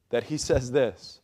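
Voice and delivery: ordinary delivery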